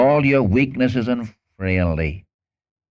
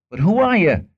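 Moses objecting; 10 semitones higher, 3 dB louder than usual. Retold or imitated. Moses objects to God, retold.

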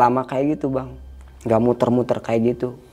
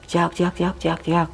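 quick run of higher chirps; imitated chick cry; 6 semitones higher, 4 dB quieter, slower than usual. Retelling the sound getting closer, imitated chick cry. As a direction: chirp like a chick, evenly repeated. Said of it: "kedengaran suara kayak ayam".

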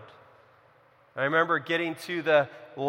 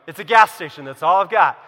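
louder, exasperated at the obvious; exasperated outburst; 11 dB louder than usual. Retold or imitated. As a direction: throw up your hands: it's a gas station. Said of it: "I'm like".

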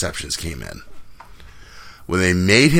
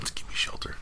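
whisper; 15 dB quieter than usual. Pretend. Singing-whisper of song title.

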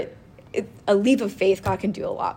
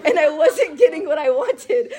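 higher, giggling through the plea; laughing; 8 semitones higher, 4 dB louder than usual. Retold or imitated. Retelling her stubborn prayer to God, laughing. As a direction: laugh at your own stubbornness.

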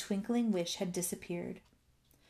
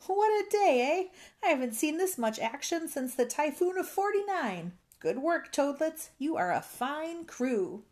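excited, high-pitched captain's holler; shouting; 8 semitones higher, 3 dB louder than usual. A narrator's reading. Captain praising his crew after the rescue, shouting. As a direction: crow it out, pitched well up. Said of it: "Captain Dalbert shouted".

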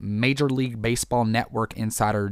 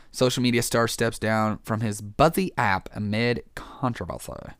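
usual speaking voice

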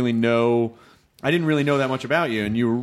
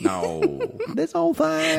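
high, exaggerated Southern twang; hammed-up Tennessee drawl; put-on Southern accent; slower than usual. Shown as a demonstration. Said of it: "that's what I can hear".

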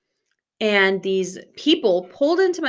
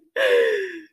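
startled gasp; 11 semitones higher than usual. Retold or imitated. Waking from nightmare.

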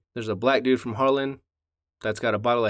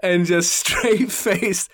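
high, slow words broken by laughter; laughing while talking; 8 semitones higher, 3 dB louder, much slower than usual. Real, not acted. Brother recalls telling mom the joke, laughing. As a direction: struggle to get it out through giggles. Teller cracking up at own joke.

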